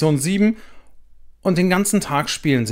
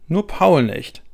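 plain reading voice

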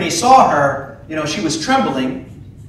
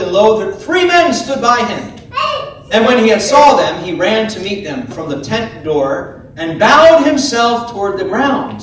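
raised, measured Bible reading; scripture reading; 2 semitones higher, 3 dB louder than usual. Acted. Preacher reads Genesis aloud to the congregation, measured. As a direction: read the verses out to the church.

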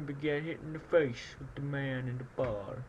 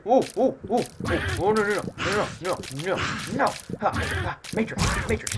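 high, loud whoa and no; panicked yelps; 8 semitones higher, 5 dB louder, faster than usual. Real, not acted. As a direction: yelp while dodging. Dodging attacks in panic.

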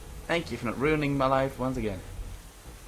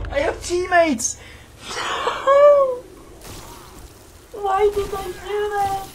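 much higher, louder cry with laughter; excited panic; 5 dB louder than usual. Real, not acted. Realizing teammates are there, high laughing panic.

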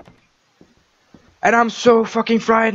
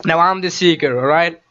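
ordinary talk

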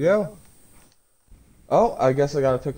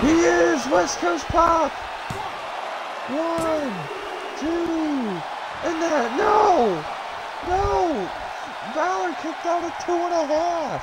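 wrestling commentator; slower than usual. Acted. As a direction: scream the near fall like a ringside announcer. Climax of match call.

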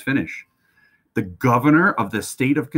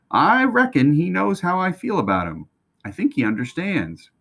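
put-on boy's voice; 9 semitones higher than usual. Boy voiced in story.

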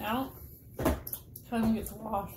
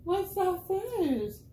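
teasing; 9 semitones higher, 4 dB louder, much slower than usual. Real, not acted. Teasing friend's face.

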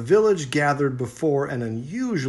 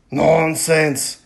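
louder, stubborn chief; dismissive chieftain; 4 semitones higher, 10 dB louder than usual. A narrator's reading. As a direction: scoff at the girls. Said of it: "replied the chieftain".

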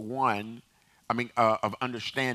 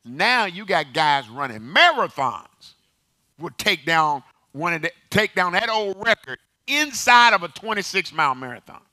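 loud, high, building pulpit exclamation; impassioned preaching; 7 semitones higher, 10 dB louder than usual. Real, not acted. Preacher marvelling at runners beating the old record, loud and impassioned. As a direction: drive it home with rising force.

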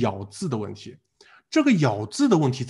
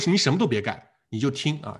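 ordinary talk

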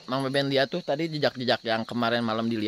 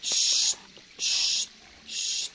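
hushing; much slower than usual. Real, not acted.

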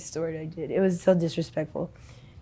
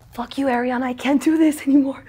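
higher, strained retold outburst; pitched-up exasperated friend; upset friend quote; 6 semitones higher, 3 dB louder than usual. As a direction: play the fed-up friend. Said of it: "She's like".

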